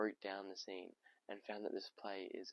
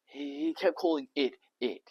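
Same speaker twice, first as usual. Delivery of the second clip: loud, high, incredulous protest; exasperated outburst; 8 semitones higher, 14 dB louder than usual. Acted. Tom mocking the doctor's word for sex, loud exasperation. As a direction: burst out at the silly euphemism.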